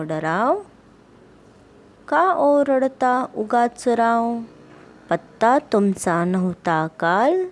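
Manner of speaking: slow singsong verse with swooping, held line endings; poem recitation. Acted. Teacher reciting the frog poem to pupils, slow singsong recitation.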